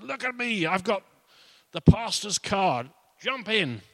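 high, boastful show-off call; cocky joyrider. Retold or imitated. Car thief shows off the pastor's car to girls, high boast. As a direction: brag about the stolen car, voice up high.

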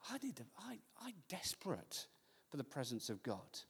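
hushed, whispered yearning; earnest whisper. Real, not acted. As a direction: drop right down to a whispered plea. Earnest plea for God's presence.

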